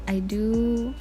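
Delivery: ordinary delivery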